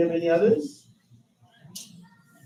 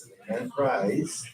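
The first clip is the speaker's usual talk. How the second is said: ordinary delivery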